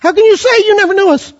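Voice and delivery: high, protesting false believers; indignant protest. Retold or imitated. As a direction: rise up in injured disbelief. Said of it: "And yet they'll say".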